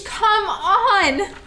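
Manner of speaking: high, eager come on; thrilled shout. Acted. Teen Pearl hurries her sister to the movie, thrilled. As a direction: bounce with excitement.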